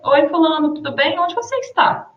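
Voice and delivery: high rising question pitch; question tune. Shown as a demonstration. Teacher demonstrating a question to students. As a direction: ask it clearly as a question. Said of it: "No caso da interrogação".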